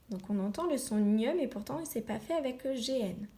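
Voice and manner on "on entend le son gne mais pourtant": ordinary delivery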